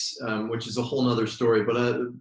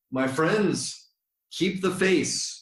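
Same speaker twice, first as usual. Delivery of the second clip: smiling doorman quote; 6 semitones higher, slower than usual. Retold or imitated. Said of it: "he would say it with such a big smile".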